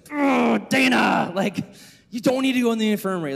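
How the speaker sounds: mock-furious counselor's outburst; mad. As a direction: re-enact losing patience with a kid. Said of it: "I was so mad".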